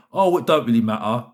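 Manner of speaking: usual speaking voice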